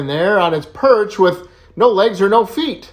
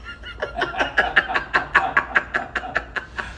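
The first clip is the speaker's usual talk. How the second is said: very high wheezing laugh; helpless, long stuttering laughter; real laughing fit; 5 dB quieter than usual. Real, not acted. Joke teller after the donkey punchline, laughing helplessly.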